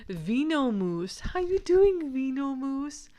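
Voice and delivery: hammed-up impression of a guy greeting a lizard; pet store guy greeting the lizard. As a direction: greet the lizard with a delighted high call. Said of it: "And he's like".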